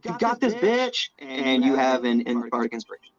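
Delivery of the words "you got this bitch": high-pitched cheer without much volume; mock yell. Scully runs up to cheer on Rebel, mock yell. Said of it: "yell at Rebel".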